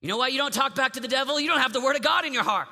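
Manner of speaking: loud, high, fast shouting; full force preaching. Acted. Preacher shouting at teens to know scripture. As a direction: drive the point home hard.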